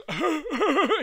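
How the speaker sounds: a high, strange imitated laugh, quickly done; imitated villain laugh. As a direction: mimic the bad girl's creepy laugh. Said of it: "She goes".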